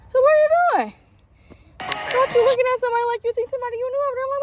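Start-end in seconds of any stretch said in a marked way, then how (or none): 0.1-3.3 high, loud startled question; jumpy and alarmed, demanding an answer; spooked. Real, not acted. Mom spooked by her son staring into the dark, asking loud and high.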